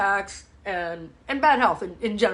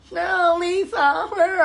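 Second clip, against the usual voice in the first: mock whiny client; 7 semitones higher, slower than usual. Client's voice acted out.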